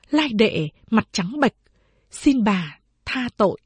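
ordinary delivery, nothing marked

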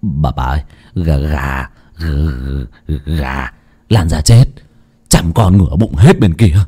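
a hint of a terrified servant; stammering in fear. Pale servant stammering to Mrs Lộc, lightly frightened. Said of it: "tái mặt lắp bắp".

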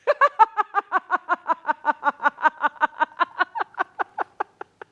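long bitter mocking laugh. Brigand laughs bitterly at the law calling them infected. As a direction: laugh scornfully at the word of the law.

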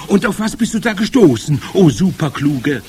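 high, loud crowd question; eager calling. A narrator's reading. Witch audience begging their clever leader, eager calling. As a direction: call out in breathless-keen admiration. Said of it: "riefen sie".

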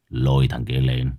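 low, cold boss voice; a crime boss giving a calm order; gang boss. A narrator's reading.

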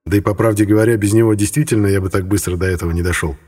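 ordinary delivery, nothing marked

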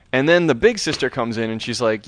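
ordinary delivery

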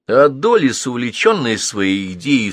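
plain reading voice

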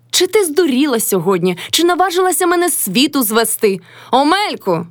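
high, outraged scolding; scold, then cry out for the husband; angry cry. A narrator's reading. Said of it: "крикнула Кайдашиха на свого чоловіка".

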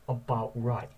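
usual speaking voice

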